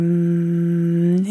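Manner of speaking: held closed-lip "mm"; imitated baby hum. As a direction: hum a long, level "mm" like a baby. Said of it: "just kept on going".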